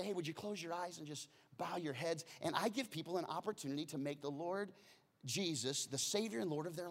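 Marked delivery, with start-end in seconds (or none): 0.0-2.2 quiet, gentle call to bow heads; soft invitation. Shown as a demonstration. Pastor inviting congregation to pray, soft and gentle. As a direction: ask them softly to close their eyes. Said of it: "I, I say".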